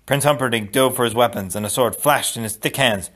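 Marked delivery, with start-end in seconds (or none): none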